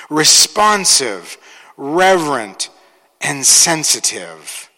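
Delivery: each word slow with pauses; slow and deliberate. Shown as a demonstration. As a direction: lay each word down slowly. Repeats words slowly.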